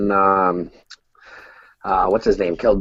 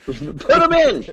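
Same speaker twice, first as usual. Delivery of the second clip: laughing echo; 12 semitones higher, much slower than usual. Retold or imitated. Friend echoes the chant laughing.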